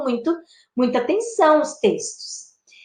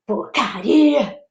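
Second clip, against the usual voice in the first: slow, drawn-out exasperation; acted teenage frustration; annoyed; much slower than usual. Shown as a demonstration. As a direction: stretch it out in vexation. Demonstrates a vexed teenage interjection.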